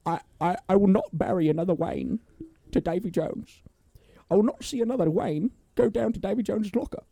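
hammed-up British butler impression; Michael Caine. Co-host as the butler refusing to bury his master, hammed-up impression. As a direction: plead solemnly as the British actor. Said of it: "Michael Caine's a fun one to do".

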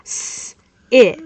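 the S hissed without voice, then it; sounded out. Shown as a demonstration. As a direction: hiss the s alone, then say it. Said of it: "What am I saying?".

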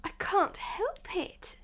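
put-on high, slow little-girl apology; meek. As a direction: answer meekly, high and slow. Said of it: "said Alice very meekly".

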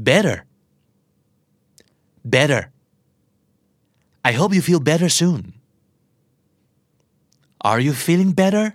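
"better" said like "bedder"; American flap T. Shown as a demonstration. Teacher drilling learners on better, flap T. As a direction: turn the T in "better" into a quick D. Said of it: "T ที่อยู่กลางคำแล้วกลายเป็นเสียงตัว D".

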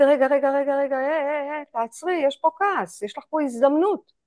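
gently halting yourself mid-anger; calm inner voice. Shown as a demonstration. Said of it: "להגיד".

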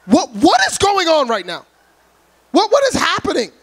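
acting out an alarmed, confused son; retold bewilderment. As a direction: stammer the question in rising disbelief. Retelling his bewildered question to mother.

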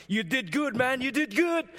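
high, rising mock cheer; excited praise. Coworker praising him in the hallway, high and excited. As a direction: hype him up like a proud friend. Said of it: "he's healing".

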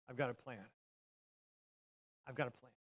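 low, hushed, deliberate statement; quiet assurance. Retold or imitated. God answering mankind's sin with a promise, quiet assurance. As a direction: drop right down, soft and low. God's plan, quoted after a pause.

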